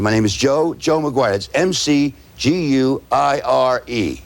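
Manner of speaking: slow, deliberate name, then spelled letter by letter; measured spelling for someone writing it down; said slowly. Shown as a demonstration. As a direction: introduce yourself and spell the surname slowly. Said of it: "You gotta say it slowly".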